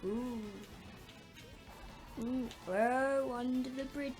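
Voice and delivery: ordinary talk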